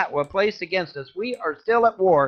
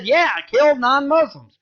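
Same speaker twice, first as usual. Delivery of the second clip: mock Quran voice; 7 semitones higher, 4 dB louder than usual. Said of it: "read where it says".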